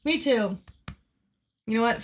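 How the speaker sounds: ordinary talk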